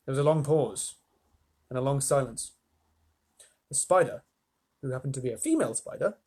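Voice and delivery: plain reading voice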